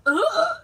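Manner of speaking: loud, high "ugh" of impact; imitated winded grunt. Little girl landing on the chair back, imitated grunt. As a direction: grunt as if hit in the tummy. Imitates child hit in tummy.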